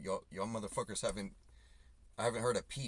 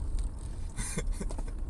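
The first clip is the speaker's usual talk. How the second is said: short breathy laugh at his own joke; 4 dB louder than usual. Real, not acted. Driver laughing at his own crude joke, breathy laugh. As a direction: huff out a quick laugh.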